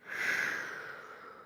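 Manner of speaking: long, exasperated exhale; weary sigh. Acted. Inmate pestered constantly through the vents, long fed-up sigh. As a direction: let out a long breath before giving in.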